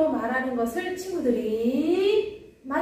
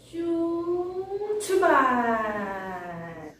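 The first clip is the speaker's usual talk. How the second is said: 길게 늘인 외침; 2 semitones higher, much slower than usual. Real, not acted. 말씀 속으로 출발 외침.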